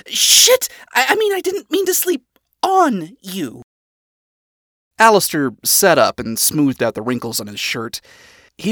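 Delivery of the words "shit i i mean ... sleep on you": high, stammered self-correction; yelp, then scramble to fix the slip; flustered panic. A narrator's reading.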